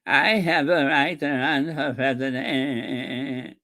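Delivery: very slow, quavering politician impression; mock wobbling voice, stretched to the end; Robert Kennedy Junior. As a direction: drag it out with an exaggerated tremor. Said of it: "that's him".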